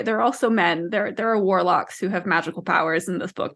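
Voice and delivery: ordinary talk, nothing marked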